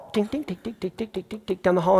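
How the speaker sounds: dog claw clicks. Retold or imitated. Tapping like claws as the dog trots down the hall. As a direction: tap out the dog's nails on the floor. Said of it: "imitating dog claws on floor".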